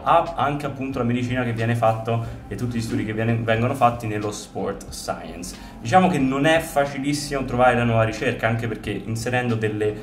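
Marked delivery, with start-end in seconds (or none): none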